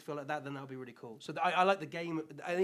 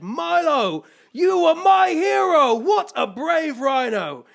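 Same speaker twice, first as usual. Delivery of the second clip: loud and much higher, booming with delight; excited praise; 13 semitones higher, 16 dB louder, much slower than usual. Acted. Rhino movie star praising Milo, loud excited proclamation. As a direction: proclaim it like a grand compliment.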